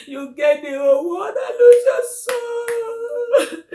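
loud, high lament; grieving wail; emotional outcry. Real, not acted. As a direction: cry out in anguish. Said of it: "I'm just so emotional right now".

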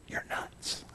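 breathy whispered punchline. Acted. Preacher teasing the congregation, whispered. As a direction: drop the joke in a bare whisper. Mock secret aside punchline.

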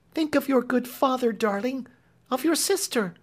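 high, coaxing maid's voice; soothing Clemency. A narrator's reading. Clemency soothing the weeping Marian, high and coaxing. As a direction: comfort and coax her gently. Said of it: "soothing her".

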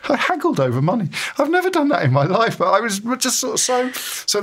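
high, gleeful disbelief; excited. Real, not acted. Proud of haggling with the station boss, high and gleeful. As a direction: squeal it out, delighted with yourself.

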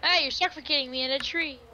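complain, outraged; indignant complaint. Real, not acted. Friend complains loudly.

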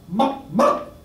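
a clucking "Book, book!" like a hen; chicken imitation. Telling the librarian joke, clucking "book" like a chicken. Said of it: "You know, like a chicken".

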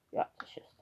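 whispered aside to herself, voice dropped right down. Real, not acted. Potter whispering to herself over a glaze slip. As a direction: breathe it privately, not for the viewers. Muttering to herself while painting.

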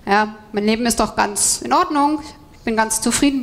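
usual speaking voice

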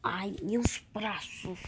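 slow pained whisper. Real, not acted. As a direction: breathe it out softly. Hurt reaction while playing.

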